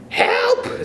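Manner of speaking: shrieking for help, pitched very high; a panicked high scream of help. Acted. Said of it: "screamed Missus Twit".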